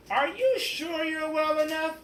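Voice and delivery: a fretting principal checking on her; concerned principal. Acted.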